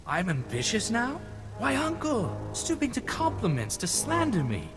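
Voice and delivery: high-pitched, mocking indignation; sarcastic, high-pitched retort; affronted sarcasm. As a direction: taunt the accuser with offended scorn. Sneering retort to uncle.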